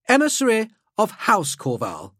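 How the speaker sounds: high-pitched, stately nobleman; Helmont Corval. Acted. Helmeted noble giving his title to Drake, high and formal. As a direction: state your title like a lofty aristocrat. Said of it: "his voice reverberating through the mouth grill".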